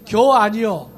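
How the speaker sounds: 충청도 사투리로 툭; Chungcheong dialect. Retold or imitated. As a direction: drop it punchily in Chungcheong dialect. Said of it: "우리 충청도가".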